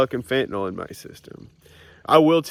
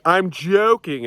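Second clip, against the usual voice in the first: higher and louder, "joking" drawn out slow; chirpy quip; 7 semitones higher, 4 dB louder, slower than usual. Retold or imitated. Telling people after a joke, a slow bright quip. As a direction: toss it off brightly. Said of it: "when I'm like".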